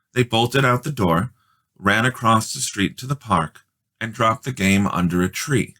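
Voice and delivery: usual speaking voice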